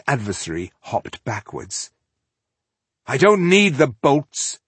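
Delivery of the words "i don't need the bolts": slow, loud and high battle shout. Acted. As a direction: bellow it while attacking. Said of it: "Slynn shouted".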